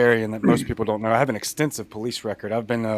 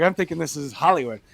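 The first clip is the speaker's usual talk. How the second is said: ordinary talk, nothing marked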